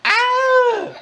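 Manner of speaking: high mock scream. Startled shopper spotting crows lets out a high squeal.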